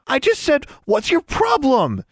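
put-on very high, indignant girl; accusing protest. A narrator's reading. Tomo quotes herself to Anna, protesting indignantly. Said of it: "flinging accusations back and forth".

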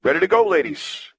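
put-on eager kid voice; young boy voice. Echo calls them.

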